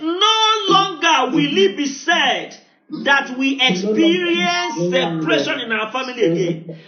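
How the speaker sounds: loud, high, building prayer declaration; fiery preacher declaring over the congregation; fervent preaching. Real, not acted. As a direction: preach it at full force, rising.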